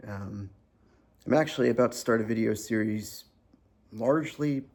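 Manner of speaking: ordinary talk, nothing marked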